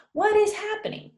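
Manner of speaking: high bright greeting call; high, friendly what is happening; casual greeting. Shown as a demonstration. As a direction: toss it out like hello to a friend. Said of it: "sometimes people say".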